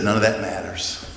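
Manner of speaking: low, soft, settled statement; understated, private resolve; quiet dismissal. Acted. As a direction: say it low and softly, letting it go. Paul's dismissal, first quiet take.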